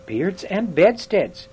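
drawn-out "Beards and bedsteads" in wonder; astonished oath. Acted. Dwarf hears there really is a castle, amazed.

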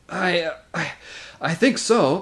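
high, halting, out-of-breath reply; winded boy gathering himself; panting. A narrator's reading. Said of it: "panted Edmund".

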